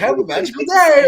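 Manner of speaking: high, chirpy theme park greeting; chirpy cast member. Retold or imitated. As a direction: cover the awkwardness with forced cheer. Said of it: "I'm like".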